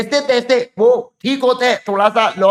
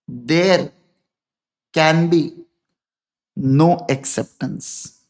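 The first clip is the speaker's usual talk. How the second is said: stretched out phrase by phrase, lower; slow dictation; 3 semitones lower, much slower than usual. Shown as a demonstration. Teacher dictating the rule to students, slow and lower. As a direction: spell it out slowly for note-taking.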